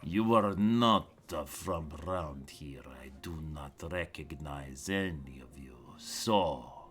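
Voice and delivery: a watch captain holding back threat, hushed then flaring; city watch captain. Acted. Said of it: "looks at you and goes".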